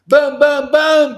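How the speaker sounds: high, loud shouted booms; yelled booms. Real, not acted. Preacher yells boom imitating eager newcomers. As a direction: pound out each boom. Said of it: "Sorry about yelling".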